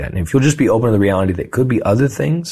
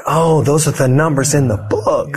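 higher, drawn-out slow "oh" of discovery; belated realization; 5 semitones higher than usual. Retold or imitated. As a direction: play the penny finally dropping. Retells their late realization.

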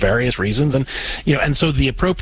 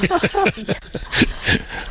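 light, high-pitched chuckle; touched laugh; 3 dB quieter than usual. Real, not acted. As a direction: laugh because you're touched, not mocking. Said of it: "I'm laughing because I".

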